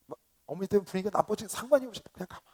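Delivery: normal talking voice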